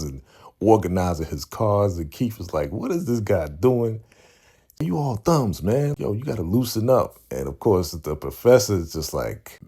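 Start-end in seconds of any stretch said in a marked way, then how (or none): 2.7-7.2 high-pitched imitation of a heckler; mocking disbelief. Keith heckling Damien's clumsy card handling, mocking disbelief. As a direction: tease him with exasperated, raised pitch.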